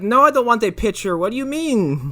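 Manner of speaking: high, loud mock-indignant protest; exaggerated retold objection; indignant refusal.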